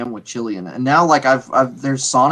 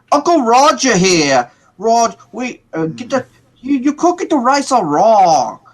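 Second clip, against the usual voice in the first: higher, louder put-on Malaysian-uncle accent, laughing; a Malaysian-English accent, hammed up and breaking into a laugh; Uncle Roger accent; 7 semitones higher, 4 dB louder than usual. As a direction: mimic a heavy Malaysian uncle scolding your rice. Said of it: "he mimics his accent really, like, really heavily".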